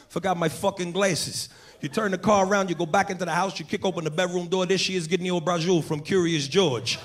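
put-on wiseguy gossip to his boys; lightly voiced Brooklyn Italian spinning a tale; Italian-American storyteller. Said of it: "he starts telling this story to his boys".

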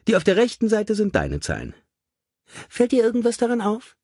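high, chirpy lady's voice; brisk cheerful lady. A narrator's reading. Mother showing her son the figures, brightly high. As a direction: hand it over brightly, lilting high.

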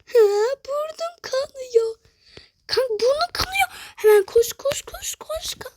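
high-pitched mock-panicked wail; play-acted panic. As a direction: wail for help, pretending your nose is bleeding. Acting out a nosebleed emergency.

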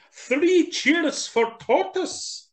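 high, slow raccoon cheer; rally the crowd. Acted. Raccoon leading cheers for Tortoise, high and slow.

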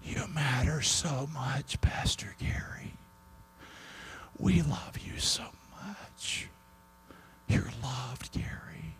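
soft breathy whisper of comfort; whispered quote. Retold or imitated. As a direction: whisper it tenderly, like a secret blessing. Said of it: "And he said".

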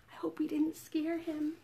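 soft, near-whispered worry; hushed. Pretend. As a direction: keep it very quiet so as not to scare. Hushed so as not to scare.

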